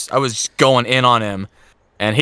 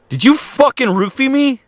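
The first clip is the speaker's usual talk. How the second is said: outraged accusation; 11 semitones higher than usual. Retold or imitated. Confronting friend on phone.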